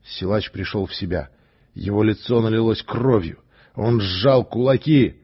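ordinary delivery

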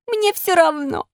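high, slow stubborn refusal; defiant puppet. Acted. Puppet warned he will get worse, stubbornly defiant. Puppet defies fairy's first warning.

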